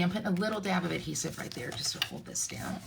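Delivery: soft quick whisper under the breath; hushed crafting aside. Real, not acted. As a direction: murmur it to yourself while working.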